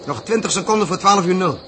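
captain barking out the seconds; loud announcement. Acted. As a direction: call the time out loudly over the engine noise. Captain calling countdown over noise.

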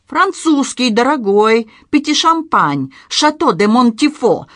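high, loud, drawn-out items; indignant listing. A narrator's reading. Professor listing his stolen cognac's virtues, indignant. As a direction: rap out each item loudly, stretching it.

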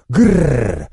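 growled, rolling werewolf roar; long rolled snarl of a hurt werewolf. A narrator's reading. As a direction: roar it as a trilled beast growl. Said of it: "взревел оборотень".

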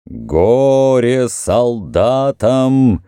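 slow, high, booming proclamation; loud proclaiming voice. Acted. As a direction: thunder it from the temple. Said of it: "возгласил громкий голос из храма".

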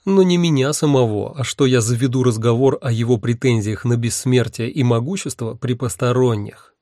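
usual speaking voice

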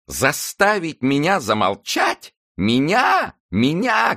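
high, rising outraged cry on Меня, slowing down; indignant shriek. A narrator's reading. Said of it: "кричала Нанетта".

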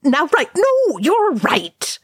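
high, frantic battle shouting; shouted. A narrator's reading. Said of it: "Oin shouted".

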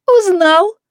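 high, loud delighted whoop; joyful yell. A narrator's reading. Neighbour recognised by old acquaintance, joyful yell. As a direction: crow with delight. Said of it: "радостно завопила оглобля".

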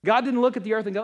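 ordinary talk, nothing marked

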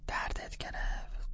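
slow, hushed whisper in the dark. Acted. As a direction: whisper so parents won't hear.

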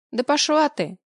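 sharp, high rude retort; angry snap. Acted. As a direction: spit it out angrily.

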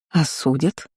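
wary echo with rising question tune. A narrator's reading. Countess alarmed by the boy's news, wary. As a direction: ask it back guardedly, ending on a rise. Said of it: "насторожилась юная графиня".

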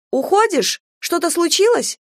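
high-pitched, surprised woman's voice; anxious questioning. A narrator's reading. Lena questions man rushing away. (встревоженно, высоким голосом)

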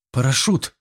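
plain reading voice

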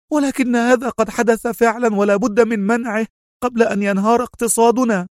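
high-pitched, insistent woman's voice; sharp retort. A narrator's reading. Mona urging the consul to act, speaking sharply. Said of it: "وهي تقول في حدة".

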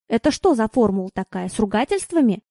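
plain reading voice

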